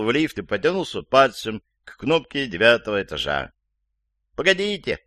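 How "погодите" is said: high-pitched cry to wait; calling out. A narrator's reading. Elderly woman at the entrance calls to hold the lift, high cry. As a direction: call out high, as from across a hallway. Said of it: "закричала от входной двери пожилая женщина".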